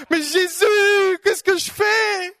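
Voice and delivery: very high, slow shouted cry to Jesus; desperate plea. Acted. Believer calling on Jesus, slow high desperate shout. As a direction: cry out slowly, lost and pleading. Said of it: "toutes sortes de situations comme ça".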